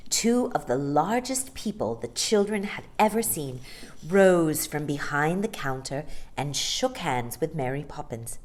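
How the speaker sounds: usual speaking voice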